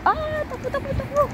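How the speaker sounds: high, urgent calls to run; excited shouting. Real, not acted. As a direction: call out to run, pitched high. Calling out while running.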